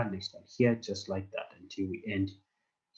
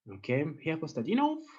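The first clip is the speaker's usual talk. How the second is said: ordinary delivery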